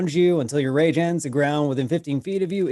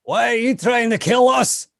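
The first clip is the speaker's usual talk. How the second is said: shouting; 11 semitones higher, 7 dB louder than usual. Acted. Barbarian shouts back at spirit.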